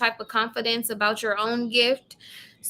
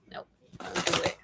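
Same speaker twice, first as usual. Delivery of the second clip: hushed warning; 8 dB quieter than usual. Real, not acted. Warning viewer against spending.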